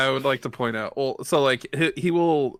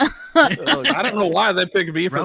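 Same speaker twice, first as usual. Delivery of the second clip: Southern knight; 6 semitones higher, 4 dB louder than usual. Acted. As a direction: grumble like a bewildered cowboy.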